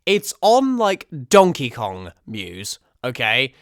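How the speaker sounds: pumped-up playful bravado; loud hyped boast. Pretend. Vlogger declaring war on the writer, loud hype. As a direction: shout it out like a fight is starting.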